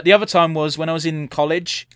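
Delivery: ordinary talk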